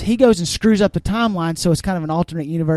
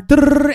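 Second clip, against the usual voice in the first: trilled drrrr, higher and louder; imitated rolling whirr; 8 semitones higher, 6 dB louder than usual. Retelling a time-travel car stunt, trilled sound effect. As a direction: roll out a loud mechanical whirr.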